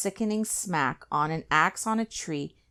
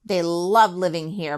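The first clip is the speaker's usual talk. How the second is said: ordinary delivery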